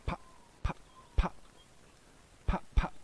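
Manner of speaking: short mouth-made pops; punchy, separate popping sounds; imitated popping noises. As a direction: pop sharply, like shots on a recording. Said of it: "sounds like popping noises".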